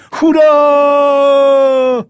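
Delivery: a long, high, full-throated roar; bellowed hurrah. Acted. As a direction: howl it as you charge the guns. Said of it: "który drze się".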